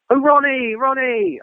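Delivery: high, eager mock call; imitated hopeful hanger-on calling out. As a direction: hail a star across the room.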